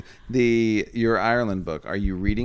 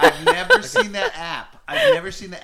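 incredulous; 6 dB louder than usual. Real, not acted. Mock disbelief at app name.

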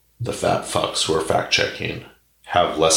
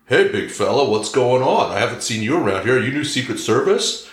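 friendly paddleboarder; 7 semitones higher than usual. Retold or imitated. Paddleboarder greeting Big Mike, higher, chummy and fast. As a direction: hail the big stranger cheerfully, pitched up. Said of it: "he's like".

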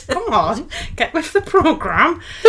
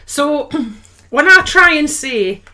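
ordinary delivery

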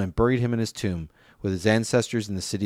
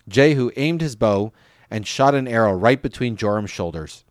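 ordinary delivery, nothing marked

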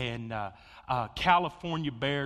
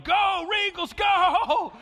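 high, loud cheering shout; yelling; 10 dB louder, much slower than usual. Retold or imitated. Pastor acting the Georgia Tech coach cheering, high loud shout. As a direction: scream him on like a frantic coach. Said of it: "is yelling".

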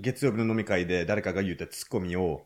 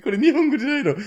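baffled; 14 semitones higher, 6 dB louder, faster than usual. Retold or imitated. Retold confusion at Sanma.

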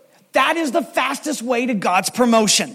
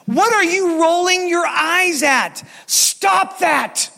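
slow, higher, louder scold ending in a shout; mock scolding; 8 semitones higher, 3 dB louder, much slower than usual. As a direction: bark it at an eye-rolling kid. Pastor scolds an eye-roller.

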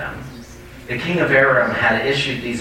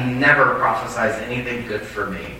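usual speaking voice

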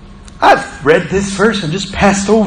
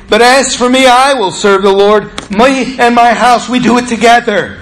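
raised, emphatic what-he-didn't-say; preacher rewording Joshua's line, raised and emphatic; preached counter-quote; 4 semitones higher, 3 dB louder than usual. Retold or imitated. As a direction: push it up higher and louder to mock the wrong reading. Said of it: "Joshua did not say".

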